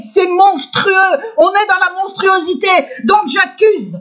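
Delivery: high-pitched, indignant denunciation; outraged accusation. Real, not acted. Outraged accusation building to j'accuse.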